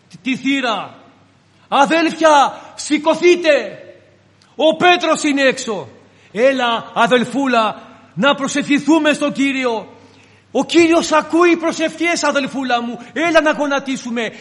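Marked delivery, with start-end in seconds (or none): 1.7-5.9 higher, louder breathless news; excited announcement. Acted. Servant girl telling the praying brothers Peter is outside, excited. 6.3-14.3 dismissive coaxing. Acted. Disciples dismiss the girl.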